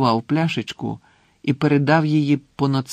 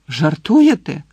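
louder, exclaimed in disbelief; indignant exclamation; 3 semitones higher, 7 dB louder, slower than usual. A narrator's reading. Harry to the portrait about the password, exclaiming. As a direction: burst out with the question. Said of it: "вигукнув Гаррі".

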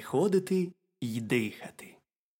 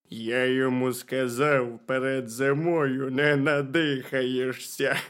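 slow, loud little bear; hearty, slow-talking bear; 5 dB louder, slower than usual. A narrator's reading. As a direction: draw it out as an unhurried bear. Bear retells his remark.

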